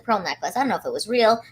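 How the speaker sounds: ordinary talk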